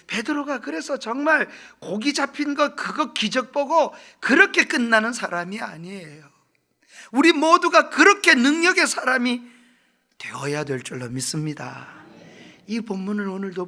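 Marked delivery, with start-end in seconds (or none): 0.1-9.4 full-force revival preaching; fiery preaching. Acted. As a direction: preach it to the back row with rising fervour. Preaching climax.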